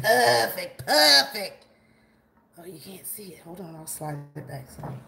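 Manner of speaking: usual speaking voice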